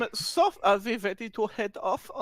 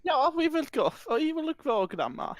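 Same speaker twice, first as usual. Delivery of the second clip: high, prim posh woman; lightly voiced posh woman fussing; posh lady voice; 7 semitones higher than usual. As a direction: play the flustered refined lady handing over a purse. Posh woman answers grandma.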